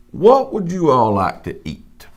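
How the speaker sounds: ordinary delivery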